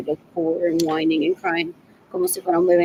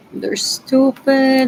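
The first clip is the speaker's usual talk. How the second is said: slow, loud, stretched out "They're stupid"; drawn-out scorn; 4 semitones higher, 8 dB louder, much slower than usual. Real, not acted. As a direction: drag out "stupid" with loud contempt. Ranting about abusive cousins.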